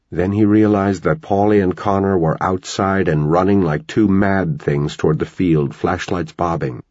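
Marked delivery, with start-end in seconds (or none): none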